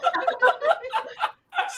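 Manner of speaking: loud, high burst of laughter; real high-pitched cackling laugh; big laugh. Real, not acted. Laughing at the teasing.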